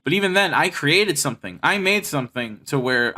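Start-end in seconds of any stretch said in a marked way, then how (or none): none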